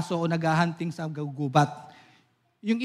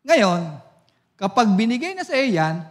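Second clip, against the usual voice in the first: usual speaking voice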